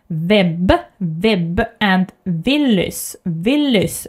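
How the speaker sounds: web said as veb. Shown as a demonstration. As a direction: Willys said as Villys. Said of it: "the sound is just the same".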